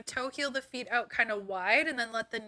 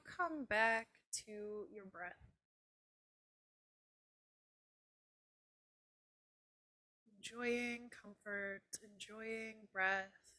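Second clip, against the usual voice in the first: guided meditation; 9 dB quieter than usual. Acted. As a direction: softly guide them into calm.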